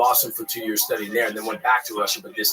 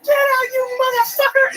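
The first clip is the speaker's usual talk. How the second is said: much higher and louder, a shrieking yell; screaming at him; 6 dB louder, slower than usual. Retold or imitated. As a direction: scream it like a woman caught changing. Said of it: "She's like".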